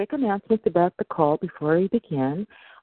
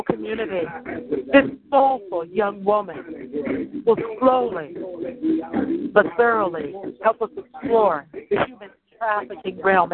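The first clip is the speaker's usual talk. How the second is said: raised, deliberate announcer delivery with pauses between phrases; show announcer; 4 semitones higher than usual. Acted. Host introducing tonight's guest Faye to listeners, show announcer style. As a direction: present the guest with ceremony, phrase by phrase.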